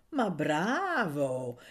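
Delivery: slow, stretched mock admiration; mocking praise. A narrator's reading. Daga teases his romantic companion, mocking. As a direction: draw out the bravo with a teasing swoop. Friend mocks Anania's poetic remark.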